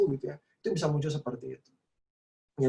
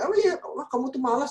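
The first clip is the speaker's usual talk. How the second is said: much higher, mildly hammed-up nagging; mock scolding parent; 10 semitones higher than usual. A parent calls the child lazy in a mock higher voice. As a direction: tease the kid as a lazy one, a light put-on.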